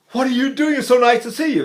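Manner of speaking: high, delighted greeting after a gasp; gasp, then greet an unexpected friend; happy gasp. Shown as a demonstration. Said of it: "Or the happy gasp".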